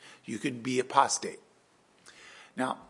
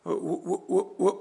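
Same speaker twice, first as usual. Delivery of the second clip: nervous stutter with a laugh, climbing higher; stammering Saul; 6 semitones higher, 4 dB louder than usual. Acted. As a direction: trip over the first word like a caught man. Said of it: "And Saul said".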